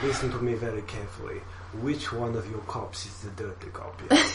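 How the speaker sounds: a quiet Russian menace; Russian accent. Acted. Villain interrogating the cops, quiet Russian accent. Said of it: "I can't do accents".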